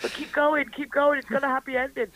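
high-pitched coaxing; urgent encouragement. Retold or imitated. Artist urging a tearful reader onward, eager encouragement.

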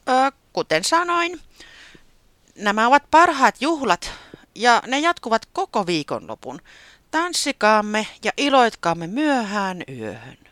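high, loud little bird voice making a toast; Taputi speech. A narrator's reading. Taputi toasting the party guests, high and loud. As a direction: proudly address the guests as the small host.

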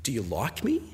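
soft, low, rising question; tentative question. Acted. Rejected suitor to his girlfriend, softly doubtful. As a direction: ask it quietly, unsure of the answer.

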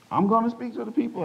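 you can't believe what you're hearing; a shy man stunned by a stranger's prophecy; incredulous question. Retold or imitated.

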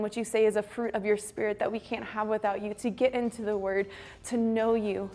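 soft, earnest prayer over music; quiet closing prayer. Real, not acted. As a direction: pray gently for the group, winding down. Closing prayer after sermon.

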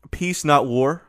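normal talking voice

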